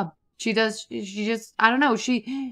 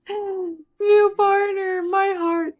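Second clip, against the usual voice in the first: high, slow squeal of delight; 10 semitones higher, much slower than usual. Real, not acted. Gamer seeing her Sims become partners, squealing delight. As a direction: melt over it. Gushing over new romance.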